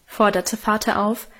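ordinary delivery, nothing marked

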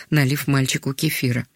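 plain reading voice

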